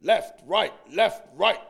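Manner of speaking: loud marching drill call; loud parade-ground left right; drill commands. Retold or imitated.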